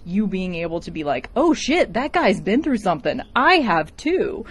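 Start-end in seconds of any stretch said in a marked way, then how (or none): 1.3-4.4 mock realization. Imagined viewer relating.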